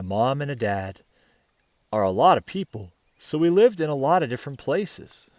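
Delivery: put-on gentle read-aloud voice for children; picture book reading to kids; storybook narration. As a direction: tell it like a bedtime story.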